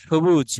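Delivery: সবুজ with everyday Bengali 'sh'. Shown as a demonstration. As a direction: say সবুজ as 'shobuj'. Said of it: "বাংলায় যেরকম উচ্চারণ করা হয়". (বাংলা উচ্চারণে সবুজ)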